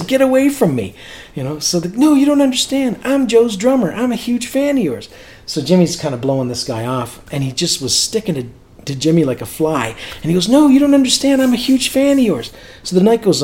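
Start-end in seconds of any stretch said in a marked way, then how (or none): 0.0-0.9 high, irritated dismissal; imitated brush-off. Singer to pestering stranger, imitated irritated brush-off. 1.9-5.1 high-pitched imitation of a pleading fan; insistent fan. Rebuffed stranger to singer, imitated insistent fan. 10.5-12.5 high, loud imitation of a desperate fan; insistent fan. Fan sticking to singer like a fly, imitated frantic insistence.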